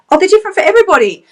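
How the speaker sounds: a quick high excited repeat; excited burst. Real, not acted. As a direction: fire the point back out with glee. Excited repeat of her point.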